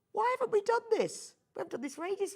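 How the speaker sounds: high-pitched indignant self-questioning; mock astonishment. Presenter retelling her sudden thought about the attic window, mock astonished. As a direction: voice a sudden realisation, pitched right up.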